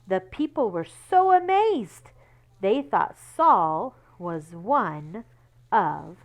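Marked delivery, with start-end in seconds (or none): none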